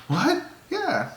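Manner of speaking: high mock disbelief; surprised wife. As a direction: squeak it like the stunned wife. Wife voiced.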